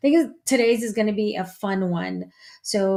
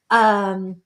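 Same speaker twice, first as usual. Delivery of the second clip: ordinary talk, nothing marked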